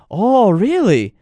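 high, slow, wide-eyed "really?"; imitating an amazed foreigner. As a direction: act stunned by what you're seeing. Wife watching cops, amazed.

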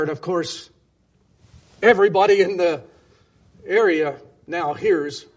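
ordinary talk

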